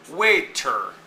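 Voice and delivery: waiter with WAIT stressed, ER light; stress on first syllable. Shown as a demonstration. Teacher giving an example word, waiter with WAIT stressed. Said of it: "The wait part is stressed".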